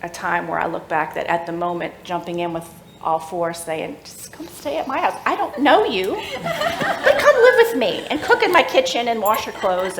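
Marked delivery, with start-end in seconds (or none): 4.0-10.0 high and loud, laughing through the quote; mock her own rash offer with a laugh; laughing at herself. Mocking her own impulsive invitation, laughing high.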